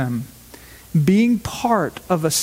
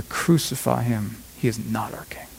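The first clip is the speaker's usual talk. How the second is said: low, dropping to a whisper; hushed condemnation; 10 semitones lower, 6 dB quieter than usual. Retold or imitated. Crowd turns on Jesus, lower and lower into a whisper. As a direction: let the rejection sink to a whisper.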